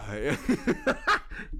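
high-pitched burst of laughter; amused laugh. Real, not acted. Storyteller after the deadlifting argument, laughing. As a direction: let a high giggle burst out. Laughs at own retold anecdote.

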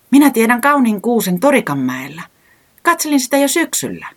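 high, loud and pleased; delighted. A narrator's reading. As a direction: glad to share his secret tree. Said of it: "vastasi säämies ilahtuneena". (korkealla, ilahtuneena ja kovaa)

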